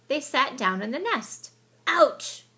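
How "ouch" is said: high loud yelp; yelled ouch. A narrator's reading. Mole poked by stick, high loud yelp. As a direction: yelp from a sharp poke. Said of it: "yelled Mole".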